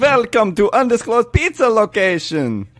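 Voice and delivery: Dracula accent. Shown as a demonstration. Worker greeting pizza customers, hammy Dracula accent. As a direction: greet customers like a vampire count. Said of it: "You lost the accent".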